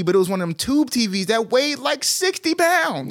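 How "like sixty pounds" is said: high, slow sixty pounds; exaggerated emphasis. Real, not acted. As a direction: stretch it for effect. Exaggerating heavy TV.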